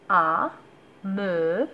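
normal talking voice

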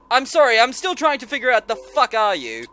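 normal talking voice

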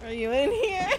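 nervous laughter. Real, not acted. Scared laughing search.

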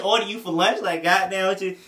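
ordinary talk, nothing marked

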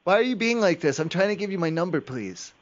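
ordinary talk, nothing marked

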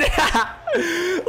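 high, shrieking laugh; crying laugh. Real, not acted. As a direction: laugh until it breaks. Laugh after the goal.

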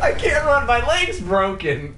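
laughing loud and high. Real, not acted. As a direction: gasp it out while laughing. Laughing through the line.

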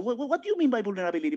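ordinary delivery

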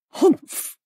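high, loud huff; disdainful snort. A narrator's reading. Critical guest scoffing at the hostess's explanation, loud disdainful snort. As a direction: snort your disapproval. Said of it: "Missus Boyle snorted".

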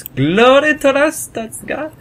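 slow, high-pitched mock patriotic proclamation; fervent patriotic cry. Proclaims the game's national slogan.

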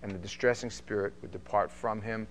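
measured, steady Bible reading; formal reading of scripture aloud. Acted. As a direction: read the passage with weight, slowing on key lines.

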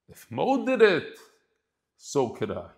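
quoted resolve. Acted. Quoted thought inside the email.

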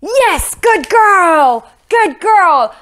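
high, loud praise burst for the dog; excited yell. Real, not acted. Trainer yelling praise at her dog, high and loud. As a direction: yell the praise with real delight. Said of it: "Sorry if I yelled in the microphone".

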